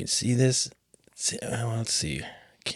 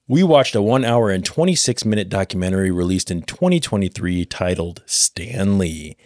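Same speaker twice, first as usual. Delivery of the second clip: ordinary talk, nothing marked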